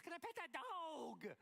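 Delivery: put-on squeaky high, pleading little girl; high little girl plea. Pastor imitating a little girl begging to pet a bear, high pleading voice. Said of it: "one more time she goes".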